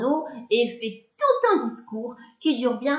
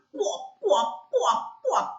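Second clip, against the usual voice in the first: higher, strangled hiccup croaks; hiccuping frog croak; 6 semitones higher, much slower than usual. A narrator's reading. Tied-up frog hiccuping furiously at the boy. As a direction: gulp each pouah like a hiccup. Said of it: "en hoquetant comme elle peut".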